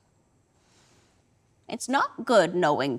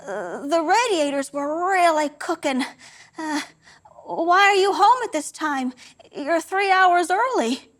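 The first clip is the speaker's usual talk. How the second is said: higher, rattled voice piling up excuses; nervous excuses; 7 semitones higher than usual. Acted. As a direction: talk fast to cover a lie, voice up. Nervous son making excuses.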